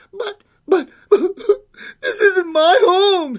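put-on very high, loud, stammering child; dismayed little girl. Girl protests to creature on lonely hill, high wail.

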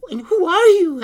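put-on high, loud little-girl voice; little girl asking. A narrator's reading. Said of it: "asked the little girl".

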